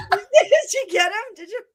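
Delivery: high, breathless laughing; laughing cry. Real, not acted. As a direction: ask through helpless laughter. Laughing fit, cramping.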